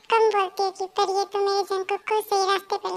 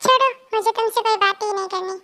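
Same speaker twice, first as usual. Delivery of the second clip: ordinary talk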